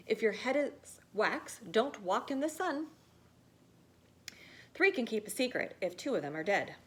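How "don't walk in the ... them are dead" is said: ordinary delivery, nothing marked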